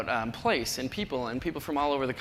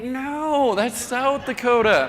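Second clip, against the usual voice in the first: higher, louder, slow correction; correcting them; 7 semitones higher, 5 dB louder, much slower than usual. Retold or imitated. The speaker corrects the tourist, slow louder. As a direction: spell it out slowly for them. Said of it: "And I'm like".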